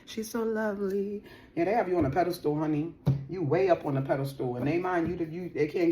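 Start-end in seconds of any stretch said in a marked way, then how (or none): 0.0-1.2 high, very slow swooning voice; mock lovesick crooning; dreamy admirer. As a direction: sigh it out like you're smitten. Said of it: "Now I'm hearing that".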